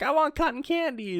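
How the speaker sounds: high little character voice; high-pitched imitation of a candy lover; Chopper. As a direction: quote a sweet-craving character in a high pitch. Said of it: "Chopper's like".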